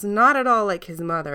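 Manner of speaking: ordinary delivery, nothing marked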